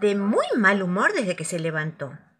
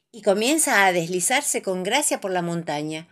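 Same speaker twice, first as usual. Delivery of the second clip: ordinary delivery, nothing marked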